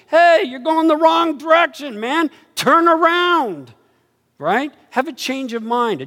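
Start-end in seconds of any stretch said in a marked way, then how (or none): 0.1-3.7 loud, high call, Turn around stretched slowly; John the Baptist calling. Retold or imitated. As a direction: call them back like a prophet. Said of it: "John the Baptist was saying".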